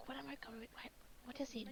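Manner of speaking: hushed, puzzled whisper. Retold or imitated. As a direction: whisper it to yourself. Retelling confused calendar moment.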